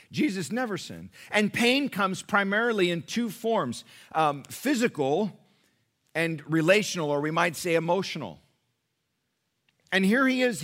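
high, loud, impassioned preaching; preaching at full force. Acted. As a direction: pour it out to the congregation with rising fervour.

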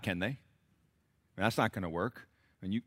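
ordinary talk, nothing marked

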